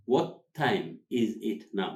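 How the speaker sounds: slow, each word set apart; slow word-by-word model. Shown as a demonstration. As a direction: spell out the phrase for learners, slowly. Teacher repeats it word by word.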